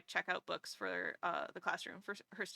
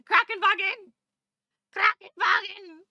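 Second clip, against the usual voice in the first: shouted German; 10 semitones higher, 11 dB louder than usual. Pretend. Listing German words to chat, shouting Krankenwagen loud. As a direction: bark it out high and loud. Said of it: "German words shouted".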